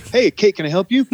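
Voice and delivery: high bright offer of help; cheery offer to help. Retold or imitated. Manager offering help to busy Kate, high and cheery.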